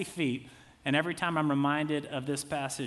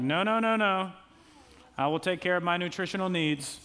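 stubborn refusal; 3 semitones higher, 3 dB louder than usual. Retold or imitated. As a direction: wave off the help with stiff pride. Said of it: "You're like".

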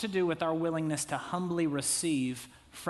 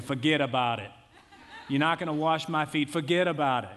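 tough New York mobster, louder; Mafia accent; 4 dB louder than usual. Retold or imitated. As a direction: play Peter as a Mafia wiseguy, loud and dismissive. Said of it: "this is kind of Mafia Peter".

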